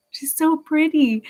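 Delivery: slow, high imitation of a small child; little girl. Little girl walking by someone she admires, imitated child voice. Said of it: "the little girl just walked by".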